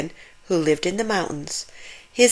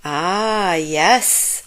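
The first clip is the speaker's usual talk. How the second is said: sighing. A narrator's reading. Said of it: "sighed Grandfather".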